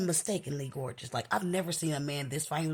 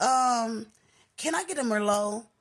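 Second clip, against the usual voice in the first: higher-pitched order with some sass; a little attitude; 5 semitones higher, 4 dB louder than usual. Retold or imitated. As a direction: ask louder, with a touch of attitude. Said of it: "little attitude type of".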